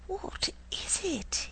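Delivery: high, slow whisper; hushed awe. A narrator's reading. Doodle stares at the strange bird, whispering in awe. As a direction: breathe the question in wonder. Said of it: "he asked".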